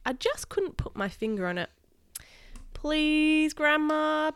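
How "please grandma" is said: high, drawn-out plea; high wheedling appeal; begging. Acted. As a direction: plead like a kid wanting a treat. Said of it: "begged Kenny".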